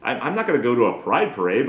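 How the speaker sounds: ordinary talk, nothing marked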